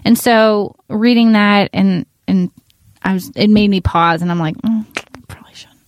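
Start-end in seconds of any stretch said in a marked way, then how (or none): 4.6-5.8 near-whispered, hesitant musing; barely voiced, reluctant admission; hushed second thoughts. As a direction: murmur it softly, as if thinking to yourself. Quoting own hesitant second thoughts.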